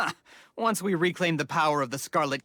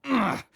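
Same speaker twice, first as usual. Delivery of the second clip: sharp, loud, high groan of revulsion; loud, high ugh of disgust; 10 semitones higher, 4 dB louder than usual. Acted. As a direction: groan as if the thought tastes foul. Disgust at the traitor goddess.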